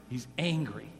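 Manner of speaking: "He's angry" dropped low and soft; hushed echo of "He's angry.". Shown as a demonstration. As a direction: let "He's angry" fall quietly. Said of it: "repeated softly".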